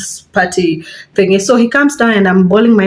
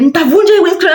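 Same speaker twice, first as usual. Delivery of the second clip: high mock threat to smash the glass; 9 semitones higher, faster than usual. Boyfriend threatening to break the windscreen, high mock threat. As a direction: imitate his threat, pitched way up. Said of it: "he goes ahead".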